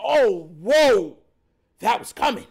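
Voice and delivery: loud startled gasp, laughing at the end; startled batter. Retold or imitated. Tracy shocked by the pitch, loud and startled. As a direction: flinch at a fastball, half laughing. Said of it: "you see Tracy go".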